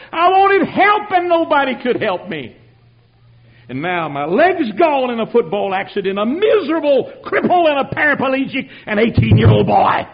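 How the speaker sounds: high, loud anguished preaching cry; a full-force preacher's lament; impassioned outcry. Acted. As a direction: pour out the old despair at full voice. Preacher recalls despair at full force.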